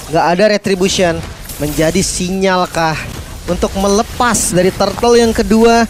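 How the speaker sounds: high, loud hype call; excited caster. Real, not acted. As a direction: scream the turtle fight. Hyped turtle fight call.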